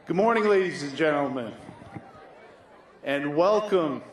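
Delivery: loud, fast announcer opening to the crowd; announcer welcome. Acted. League president greeting the crowd at opening day, loud announcer voice. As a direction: boom it out over the field like a PA announcer. Opens the league season ceremony.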